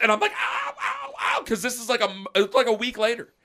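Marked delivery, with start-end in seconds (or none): 0.3-1.4 very high cries of "ow"; mock pained yelps. Son whose burn is being picked, hammed-up pained yelping. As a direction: yelp comically at being poked. Retold pain as dad picks burn.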